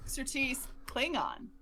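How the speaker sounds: ordinary talk, nothing marked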